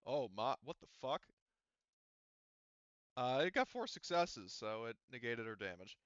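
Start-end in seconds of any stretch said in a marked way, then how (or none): none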